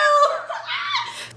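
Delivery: very high fangirl squeal-laugh. Retold or imitated. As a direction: burst into a high giddy squeal. Squeal after imagined ecstatic thanks.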